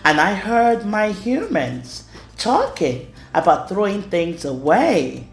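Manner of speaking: ordinary delivery, nothing marked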